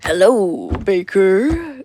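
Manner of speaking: a hint of a growling wolf; growled greeting. A narrator's reading. The wolf greets the baker with a growl. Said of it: "growled the wolf".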